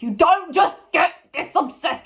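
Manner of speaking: high, loud exasperated protest; exasperated outburst. Real, not acted. Ranting at the show about Eve's obsession, exasperated. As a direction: protest it loudly, pitched right up.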